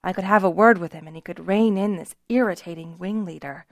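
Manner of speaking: plain reading voice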